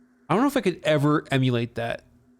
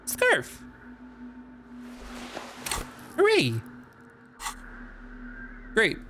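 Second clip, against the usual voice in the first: mock delight; 10 semitones higher, 5 dB quieter, slower than usual. Real, not acted. Excited over finding an item.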